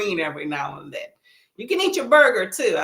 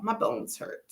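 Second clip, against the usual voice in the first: whispered, pained aside; hushed repeat; 2 semitones higher, 9 dB quieter than usual. Real, not acted. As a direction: murmura cansada: «My bones hurt» casi sin voz.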